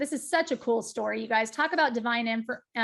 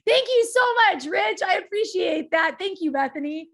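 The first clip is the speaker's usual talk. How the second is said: much higher and louder, real gratitude to the crowd; excited thanks; 6 semitones higher, 4 dB louder than usual. Real, not acted. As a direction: call out thanks over applause. Thanking audience applause live.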